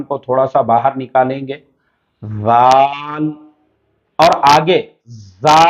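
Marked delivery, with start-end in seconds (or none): 2.2-3.3 زال drawn out, tongue between the teeth. Shown as a demonstration. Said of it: "دانتوں کے بیچ میں سے زبان". (ذال زبان دانتوں کے بیچ رکھ کر کھینچنا)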